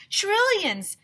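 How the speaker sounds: Mister Granite shouting, high; shouted. A narrator's reading. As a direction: top the last guess. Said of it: "shouted Mister Granite".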